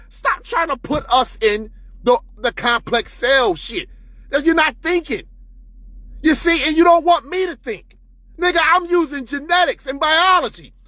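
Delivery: usual speaking voice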